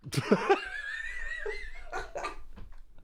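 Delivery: very high mocking cackle; villainous laugh. Bully cackling at the scared partner, very high. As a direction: cackle gleefully over the theft.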